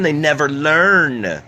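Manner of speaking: they never learn, stretched out slowly and firmly; slow, weighted echo; slow emphatic repeat. Acted. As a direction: drag each word out to drive it home. Emphatic repeat, slowed take.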